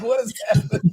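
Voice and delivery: mock bewildered. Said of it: "I'm like".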